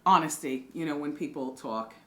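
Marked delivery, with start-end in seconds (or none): 0.0-0.7 emphatic. Real, not acted. Laughing at Shakana's behind.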